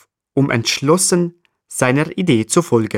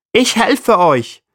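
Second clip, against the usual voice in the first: bird voice; 10 semitones higher, 3 dB louder, slower than usual. Acted. Jackdaw offers help.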